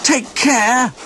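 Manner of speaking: high, loud call of take care; shouted farewell. A narrator's reading. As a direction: call after departing friends. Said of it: "shouted their friends".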